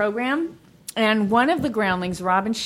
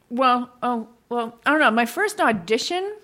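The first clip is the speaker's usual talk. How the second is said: ordinary talk, nothing marked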